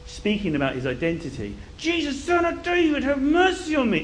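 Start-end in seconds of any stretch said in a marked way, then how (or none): 1.8-4.0 high-pitched insistent cry; loud calling. Acted. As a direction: keep calling out high, without long pauses. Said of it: "And he's loud and loud".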